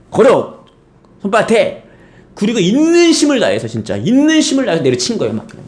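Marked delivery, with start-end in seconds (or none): 0.1-1.8 high, barked command; angry father's order. Retold or imitated. Furious father ordering his child to hold out a hand. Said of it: "아버지가 화가 너무 나가지고".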